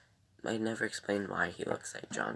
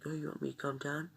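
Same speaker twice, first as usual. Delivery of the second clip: mock sarcastic retort; 8 semitones higher, faster than usual. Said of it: "And he's like".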